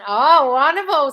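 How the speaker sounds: high, loud, drawn-out delight. Real, not acted. Coach hearing a viewer's flirting success, delighted. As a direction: gush slowly over good news.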